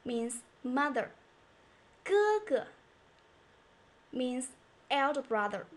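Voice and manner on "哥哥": "哥哥" first syllable high and held, second dropping short; light neutral tone. Shown as a demonstration. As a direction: hold 哥 high, then let the second fall lightly. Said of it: "repetition of the first one".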